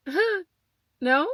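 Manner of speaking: a startled, unsure no; confused surprise. Retold or imitated. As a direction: pitch jumps way up on a puzzled huh. Startled by the adoption question.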